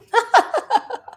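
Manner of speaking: high burst of laughter. Real, not acted. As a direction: laugh at your own joke, high. Laughs at own joke.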